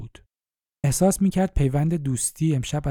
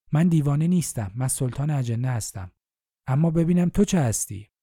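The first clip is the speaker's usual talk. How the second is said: ordinary delivery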